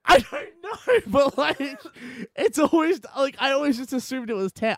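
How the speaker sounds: high, loud laughing; laughing through the words. Real, not acted. Laughing at the reveal.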